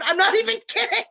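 loud, high, real laughing delivery; helpless laugh bursting through the words; breaking into laughter. Real, not acted. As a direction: crack up mid-sentence. Cracks up telling channel's origin.

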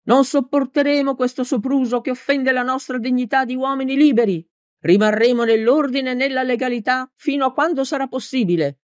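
high-pitched political oratory; balcony speech to the people; shouted speech. A narrator's reading. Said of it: "gridò Peppone".

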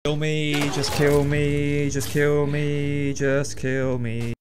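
long drawn-out, slow moan; slow exasperated surrender; despairing plea. Real, not acted. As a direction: beg for an end, stretching each word. Despairing at failing the game.

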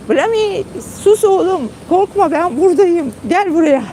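high, tender hush to a scared pet; calm the frightened dog gently; soothing the dog. Retold or imitated. Owner retelling how she soothes her dog at night, high and tender. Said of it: "ben sesleniyorum".

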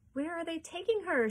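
high-pitched alarmed cry; shrieked question. A narrator's reading. Mother watching squirrels take her daughter, mild shriek. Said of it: "shrieked Missus Salt".

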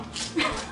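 high unvoiced burst of giggling; breathless giggle. Real, not acted. Giggling at her own silly chant. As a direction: giggle at yourself.

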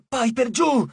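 high, tense command to duck; sharp warning to a friend in danger; urgent hissed warning. A narrator's reading. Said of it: "sibilò Jason".

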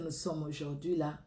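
usual speaking voice

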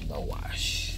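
low unvoiced whisper. Real, not acted. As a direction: breathe it in a whisper. Whispered aside.

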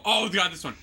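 ordinary talk, nothing marked

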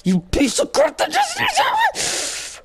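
very high, drawn-out furious squeal; angry outburst. Real, not acted. Player cursing the game monster, high angry outburst. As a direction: let rage squeeze the words into a high screech. Raging at game, then calms down.

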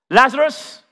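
high, loud call across the tomb. Acted. Jesus calling the dead man by name, loud. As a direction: call him out with full force. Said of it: "Jesus called out in a loud voice".

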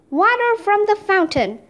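storyteller's moderate roar; restrained roar. A narrator's reading. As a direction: call out for help, short of full volume. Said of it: "Leonardo roared".

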